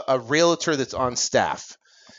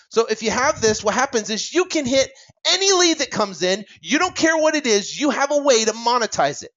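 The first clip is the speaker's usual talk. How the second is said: climbing, eager sales-pitch energy; worked up; 7 semitones higher than usual. Real, not acted. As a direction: pitch jumps high with real excitement. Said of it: "I'm getting worked up here".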